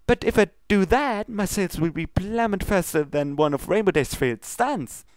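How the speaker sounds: high, bubbly pony voice, pleading; sweet baker begging her not to waste cupcakes; high Pinkie Pie voice. Acted. Pinkie pleads with Princess Luna, high and bubbly. Pinkie worried about sales.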